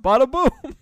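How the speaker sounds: loud, high mock holler; imitated New York shout; retold yell. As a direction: yell it back from a window. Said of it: "leaned out the window and yelled".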